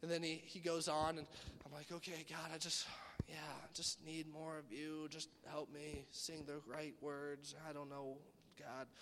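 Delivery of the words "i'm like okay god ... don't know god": hushed prayer. Retold or imitated. Youth group worship leader praying softly for more of God.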